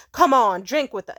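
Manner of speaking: loud insistent mock urging; pushy men. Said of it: "I'll be like".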